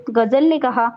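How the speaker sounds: ordinary delivery, nothing marked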